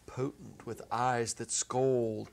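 usual speaking voice

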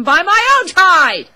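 high, loud burst of mock outrage; mock-outraged yell. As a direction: belt it out in comic indignation. Mock outrage at own expense.

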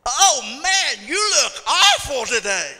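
loud, high, drawn-out exclamation; hammed-up tactless greeting; mock dismayed shout. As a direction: blurt it out in exaggerated horror.

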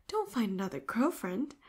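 ordinary delivery

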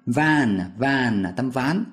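"van" said slowly, like Vietnamese "ván" rising; teacher modelling "van". Shown as a demonstration. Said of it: "mượn một cái âm thanh trong tiếng Việt đó là tấm ván".

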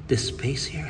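hushed retold question; whisper. Designer whispering a question about the gap, hushed.

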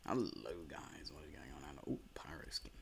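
very soft, hushed hello; soft whisper intro; whispered greeting. Real, not acted. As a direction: whisper to viewers. Quiet greeting then whisper.

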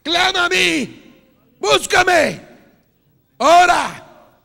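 loud pleading preacher call, slowing on the appeals; preaching at full force. Real, not acted. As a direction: cry out God's invitation, drawing it out. Llamado del Señor gritado. (llamado de predicador a gritos, alargando las súplicas)

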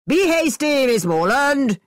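shouted. A narrator's reading. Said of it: "shouted Mister Thorpe".

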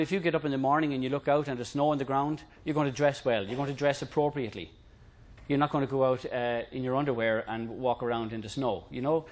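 ordinary talk

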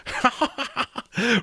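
high, thoughtful chuckle; an old skull chuckling fondly; kindly skull laugh. Acted. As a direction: let a kindly laugh roll out. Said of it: "the skull was laughing again".

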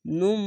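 নুম with the final m held as a long hum; n turned to m. Shown as a demonstration. Teacher showing the small mim, নুম with sustained m. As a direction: close the lips on নুম and keep humming the m. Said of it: "মিম উচ্চারণ হবে".

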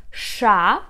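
sha with a hushing sh; letter sha. Shown as a demonstration. Teacher modelling the letter sha for learners. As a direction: say sha with a clear sh hiss. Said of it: "how to pronounce such Ukrainian letter as".

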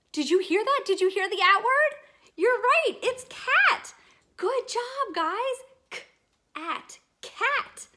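high, loud and thrilled; excited. Acted. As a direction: gasp and cheer the new word. Excited discovery of cat.

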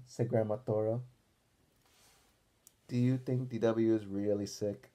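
ordinary delivery

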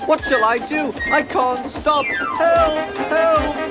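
high panic building to loud cries; panicked cries. A narrator's reading. Runaway engine with no driver, high panicked cries. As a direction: cry out high, rising to shouts for help.

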